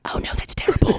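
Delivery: hushed mock concern; sympathetic whisper. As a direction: imitate staff whispering back in sympathy. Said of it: "I have to whisper with him".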